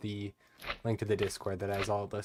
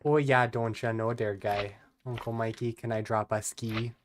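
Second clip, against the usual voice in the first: a light, hammed-up Upper Midwest accent; mock Wisconsin accent. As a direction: play a folksy Wisconsin local, half-joking. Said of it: "made fun of my accent".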